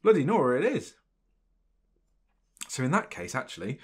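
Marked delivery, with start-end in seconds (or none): 0.0-1.0 loud, high astonished outburst; shocked at how soon it is; real surprise, high and loud; surprised exclamation. Real, not acted. Surprised by near cup date.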